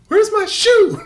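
high, laughing imitation of a girl; high mimic of girl missing her shoe; mock whiny girl. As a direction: squeak it out like the panicked girl.

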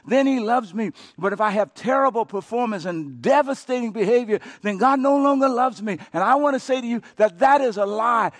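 climbing from speech to a loud preached cry; building sermon. Real, not acted. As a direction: preach it harder line by line. Preacher builds to full force.

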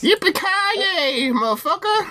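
very high, slow cowboy war cry; Samuel L. Jackson cowboy. Acted. Co-host mocks American talk, very high slow cowboy cry. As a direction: stretch it out like a movie cowboy. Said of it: "a combination of Samuel L. Jackson and a cowboy".

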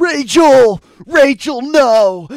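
high desperate shout; movie quote yell. Pretend. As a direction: scream her name in anguish. Quoting Dark Knight line.

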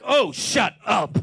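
slow, loud and scornful; dismissive God. Retold or imitated. God curtly dismissing the whining pastor, scornful. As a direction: brush him off with contempt. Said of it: "The way God dealt with me".

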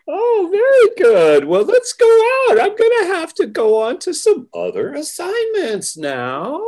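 put-on very high, sing-song Valley Girl uptalk; mock Valley Girl. Host playfully wrapping up the interview, mock Valley Girl.

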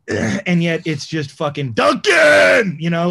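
loud emphatic rant; shouting. Real, not acted. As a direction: shout it out slow and big.

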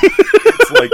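put-on high, slow, laughing man-child squeal; laughing Pee-wee squeal. Imitating Pee-wee's voice.

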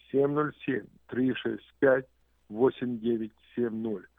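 slow, spelled-out phone number; slow number read. Shown as a demonstration. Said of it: "number repeated slowly".

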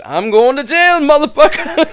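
high, loud yell of alarm; shouted quote. Retold or imitated. Friend retells a meme, yelling a bystander's line in loud alarm. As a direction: bellow it like a panicked bystander. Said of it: "some person turning and goes".

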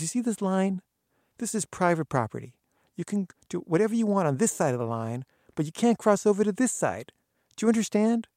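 high, loud, stern warning; stern lecture. Retold or imitated. Gardener drawing a line in the sand, stern loud warning. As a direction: lay down the law.

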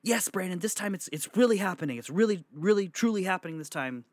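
put-on high, eager old lady voice; excited grandma. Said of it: "she's like".

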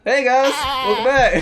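high, loud mock yell of a greeting; mock scream. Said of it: "he's just screaming".

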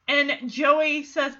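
normal talking voice

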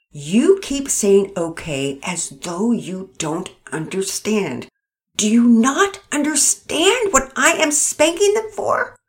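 slow, strained, rising to loud; frustrated wife pressing her husband; barely calm. Acted. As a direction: hold the temper, then let it climb. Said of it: "tried her best to stay calm".

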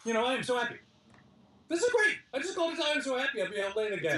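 very high, laughing through it; can't contain the delight; high-pitched laughing happiness; laughing joy. Retold or imitated. Joyful retold realization.

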